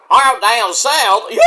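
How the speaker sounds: slow, hammed-up Southern holler; mock Southern accent. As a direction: make fun of a Southern accent. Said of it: "he makes fun of the, of the Southern accent".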